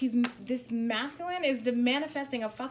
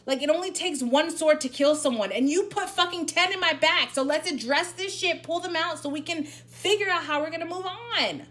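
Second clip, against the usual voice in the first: louder and higher, fed-up lecturing; fed-up channelled scolding, louder and higher; 7 semitones higher, 6 dB louder than usual. Acted. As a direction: tell them off.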